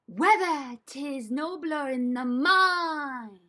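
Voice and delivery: slow, drawn-out theatrical recitation; grand, elongated line reading; moving while reciting. Shown as a demonstration. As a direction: stretch the line out as you move. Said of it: "I am going to move".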